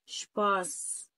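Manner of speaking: شباس stretched slowly, shp not sp; slow model of شباس; German word demo; German shp sound. Shown as a demonstration. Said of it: "هي شباس".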